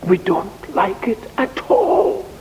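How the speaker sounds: high, rising protest; complaining. Acted. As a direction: object with mounting pitch. Acted complaint about hard times.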